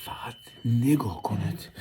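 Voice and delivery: whispered "فقط نگاه کنید" in no voice. Real, not acted. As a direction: hush it like a secret to viewers. Repeats the line whispered.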